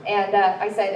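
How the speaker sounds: ordinary talk, nothing marked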